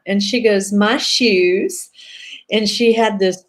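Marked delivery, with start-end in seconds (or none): 0.7-1.9 higher, light Southern drawl; a mild Southern drawl on the answer. Retold or imitated. Client under hypnosis answering in a Southern drawl. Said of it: "Southern drawl that was just like".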